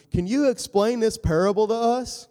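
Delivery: high, loud, begging disciple; pleading disciple. Retold or imitated. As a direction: ask like a puzzled student. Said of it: "in verse thirteen".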